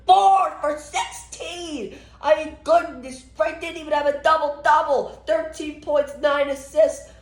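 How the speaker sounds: very high, drawn-out disbelief; exasperated wail. Real, not acted. Fan despairing at James Harden's bad game, exasperated wail. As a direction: plead in high-pitched exasperation.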